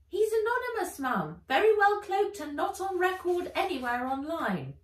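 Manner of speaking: high, loud robotic voice; robot informant. Acted. Robot informant reporting to Ivy, high and loud. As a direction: report like a little machine.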